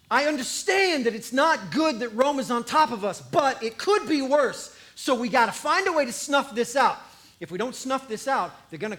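loud, insistent scheming priest; urgent. Acted. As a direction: press the council hard. Pharisee voiced arguing in council.

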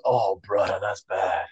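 low, soft imitation of a concerned clerk; hushed grave verdict. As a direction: drop low and quiet to break bad news. Retold clerk's grave verdict on foot.

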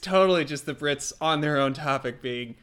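ordinary talk, nothing marked

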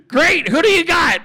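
hammed-up exasperated boss; irate boss. Acted boss demanding names.

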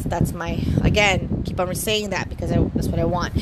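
ordinary talk, nothing marked

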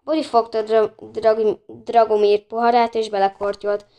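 ordinary delivery

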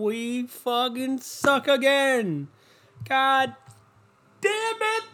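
high, slow, drawn-out whine; hammed-up sports-fan anguish; mock despair. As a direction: wail it like a crushed fan. Mock despair at his team.

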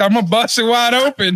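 mock tough lady. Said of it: "Is this, is this locked?".

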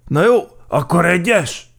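high, loud cheetah voice; Gepárd Géza agreeing. A narrator's reading. Cheetah gives in on first gear, loudly agreeing. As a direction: give in with a loud, high exclamation. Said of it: "egyezett bele Gepárd Géza".

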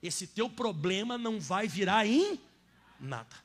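preaching at full force. Acted. Pastor to congregation, loud fervent preaching. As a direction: hammer each promise home.

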